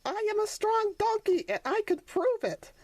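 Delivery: put-on high childlike voice puffing himself up; young donkey boasting. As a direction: prove you're strong, little one. Sonny boasting of strength.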